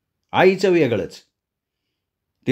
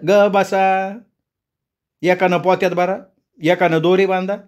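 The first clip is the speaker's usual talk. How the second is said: higher-pitched mother giving orders; bossy mother; 6 semitones higher than usual. A narrator's reading. Mother setting children to bag and tie wood, bossy. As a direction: order them about in a raised pitch.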